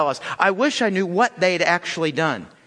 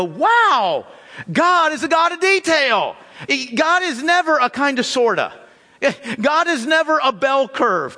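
awed exclamation; 6 semitones higher than usual. Pretend. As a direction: marvel at God's detail, rising up. What we should say leaving chapters.

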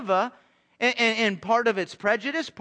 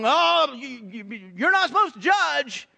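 a higher, louder put-on whiny voice; mocking retort; 4 semitones higher, 3 dB louder, slower than usual. Pastor mocking what sinners say when told they're wrong, in a whiny voice. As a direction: parody a smug unbeliever.

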